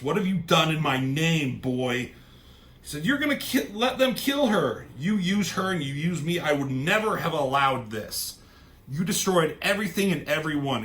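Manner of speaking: furious. Retold or imitated. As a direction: rage like a wronged father, acted out. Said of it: "And he's fucking pissed".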